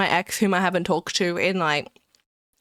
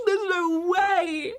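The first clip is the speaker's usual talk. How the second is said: stretched, higher there's no way; slow disbelief; 7 semitones higher, much slower than usual. Real, not acted. Streamer stunned by a gifted game, slow and high. Streamer reacts to gift, repeated.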